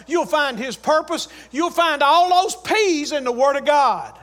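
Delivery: high-pitched, rhythmic sermon list; driving pulpit cadence; fervent preaching. Real, not acted. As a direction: build a preacher's list to a forceful close. Preaches at full force.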